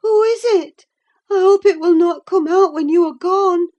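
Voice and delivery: high, loud, frightened voice; fearful of the shape in the glass; anxious. A narrator's reading. Catherine afraid of a face in the mirror, high and frightened. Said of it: "she pursued anxiously".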